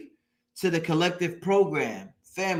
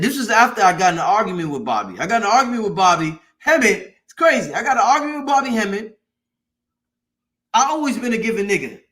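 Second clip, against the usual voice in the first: louder and faster, the telling speeds up; worked up; 7 dB louder, faster than usual. Real, not acted. To the live audience about the argument with Bobby Hemmett, a loud excited rush. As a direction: rush it out, fired up by the memory.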